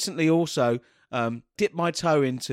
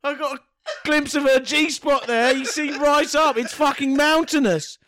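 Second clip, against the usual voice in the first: excited; 11 semitones higher, 5 dB louder than usual. Real, not acted. Giddy laughing description.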